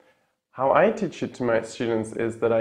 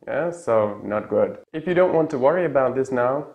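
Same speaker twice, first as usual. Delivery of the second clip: ordinary talk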